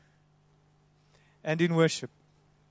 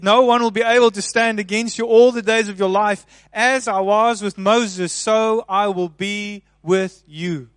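loud, higher proclamation slowing at the end; preaching at full force; 3 semitones higher, 7 dB louder than usual. Acted. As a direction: declare God's promise boldly. Proclaims God's promise to Joshua.

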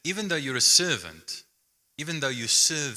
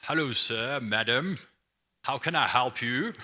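posh-ish British butler, lightly voiced; a hint of a British butler at the door; British butler accent. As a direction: greet the guest like an English butler. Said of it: "a butler with, you know, a British accent".

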